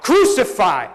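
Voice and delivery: loud, high, falling cries; crowd shouting. A narrator's reading. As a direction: shout it like a baying mob. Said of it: "they shouted".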